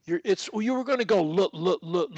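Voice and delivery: normal talking voice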